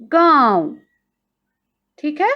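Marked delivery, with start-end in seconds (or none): 0.0-0.7 गांव with nasal आं, spoken louder; demo pronunciation. Shown as a demonstration. Teacher showing pupils the chandrabindu, nasal गांव. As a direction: say गांव with the vowel through nose and mouth. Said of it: "उच्चारण आएगा".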